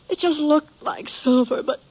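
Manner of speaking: the widow's grief spilling over as she gets to the buckle; breaking into tears. Acted. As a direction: voice cracking into a sob on the last words. Widow breaks down telling the sheriff.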